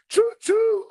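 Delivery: high mock train whistle; playful train whistle call; choo-choo. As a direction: toot it like a train.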